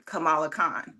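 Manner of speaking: "Kamala Khan" with middle syllable of Kamala stressed; "Kamala" said kuh-MAH-luh; Kamala stressed on second syllable. Shown as a demonstration. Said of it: "so you can pronounce that Kamala".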